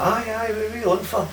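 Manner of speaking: high-pitched mock phone reply; imitated high-voiced man taking a call; eager phone dealer. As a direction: put on a high, keen dealer's voice. Acted phone reply in prank call.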